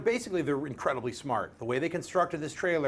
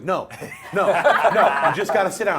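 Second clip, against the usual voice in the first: loud, raised nagging with laughter; mock insistence; 4 semitones higher, 9 dB louder than usual. Imitating a colleague who won't take no, insistent. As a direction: overrule him loudly, playing the pushy friend.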